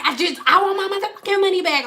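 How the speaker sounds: high-pitched, rapid outburst; mock angry demand. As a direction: imitate a fed-up customer demanding money. Retold angry refund demand.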